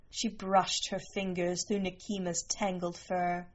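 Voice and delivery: ordinary delivery, nothing marked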